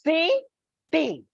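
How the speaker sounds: CITY broken in two, CI, then TY; teacher splitting "city" in half; syllable by syllable. Shown as a demonstration. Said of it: "two syllables".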